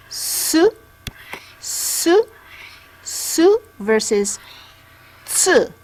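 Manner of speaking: Su said with a plain s, then tsu with a crisp t before the s; su versus tsu. Shown as a demonstration. Teacher contrasting su and tsu for listeners. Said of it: "Can you hear the difference?".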